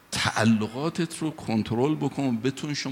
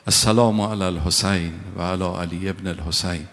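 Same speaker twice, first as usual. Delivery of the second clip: solemn, lower chanted Arabic salutation; ziyarat recitation; 3 semitones lower, 3 dB louder than usual. Acted. Preacher leads the congregation in salutation, solemn chant. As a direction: intone it like a prayer.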